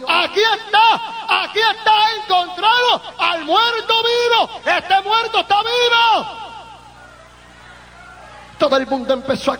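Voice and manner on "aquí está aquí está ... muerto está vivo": doctor finding the living dead man, shouting with joy; excited shout. Retold or imitated. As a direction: cry out in amazed discovery. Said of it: "empezó a gritar y dijo".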